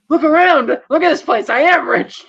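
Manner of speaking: high, loud retold protest; mock indignant boast. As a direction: push it up high and loud, showing off.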